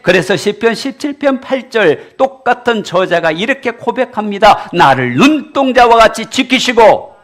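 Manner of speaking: loud, high, ringing sermon voice; fiery preacher calling out; preaching at full force. Acted. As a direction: drive it home to the congregation.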